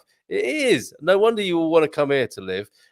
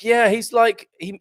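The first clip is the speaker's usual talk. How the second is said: ordinary talk, nothing marked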